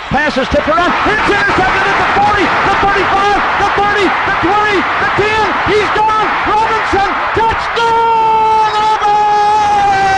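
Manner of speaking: high, laughing play-by-play; touchdown call. Real, not acted. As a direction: ride the run up to the score. Interception return touchdown call.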